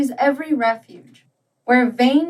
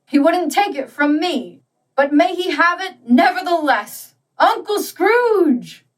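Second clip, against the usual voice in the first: high, rising merry toast; hearty toast; 7 semitones higher, 3 dB louder than usual. A narrator's reading. Nephew toasting his uncle at the Christmas party, merry and high. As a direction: raise a glass and call out the name.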